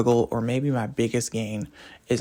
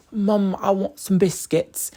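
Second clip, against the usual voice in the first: put-on slow, higher claymation British boy; fake British accent; 7 semitones higher, slower than usual. British boy asking his mom for biscuits, fake British accent. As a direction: put on a hammy little British boy's accent. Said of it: "my fake British accent is likened to, like, the claymation British accent".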